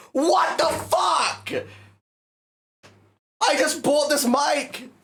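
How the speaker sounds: high, slow, furious shout; real angry shout. Real, not acted. Streamer furious his new mic got soaked, shouting high. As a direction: yell it out in outraged disbelief.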